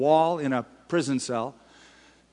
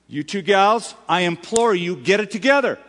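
higher, louder plea; imploring; 5 semitones higher, 5 dB louder than usual. Retold or imitated. Paul pleading with two women, raised and louder. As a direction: urge them to get it together. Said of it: "He goes".

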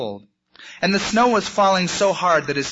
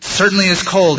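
louder, firm exclamation; 3 semitones higher, 8 dB louder than usual. A narrator's reading. Lost bunny talks to himself in a blizzard, out loud. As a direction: say it aloud against the cold. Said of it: "he said out loud".

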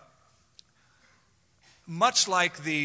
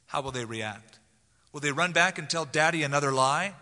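ordinary delivery

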